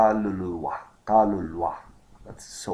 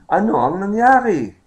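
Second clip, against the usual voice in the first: high, loud surprised questions; 8 semitones higher, 5 dB louder than usual. Acted. Teacher runs second man's line right through, surprised. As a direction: raise pitch and volume, astonished. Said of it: "The second man is surprised".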